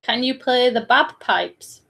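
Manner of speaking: normal talking voice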